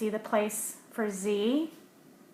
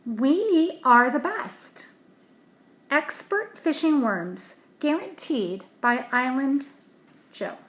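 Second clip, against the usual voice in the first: louder, higher, slow advertising boast; advert voice; 4 semitones higher, 8 dB louder, slower than usual. Acted. A worm can boasting to fishermen, louder, higher ad voice. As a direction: sell the worms like a proud advert.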